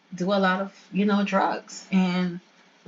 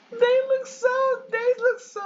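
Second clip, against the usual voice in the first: laughing. Real, not acted. Player cracking up at characters' looks.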